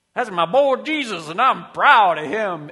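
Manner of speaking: put-on booming, drawn-out Southern accent; proud Southern father hollering from on high; big hammed-up Georgia accent, loud and high; Southern God. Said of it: "not a Californian pretending he's from the South".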